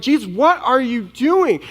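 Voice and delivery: high-pitched, panicky protest to Jesus; acted frantic frustration. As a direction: play a freaked-out disciple demanding answers. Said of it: "hear the fear and the frustration in their voices".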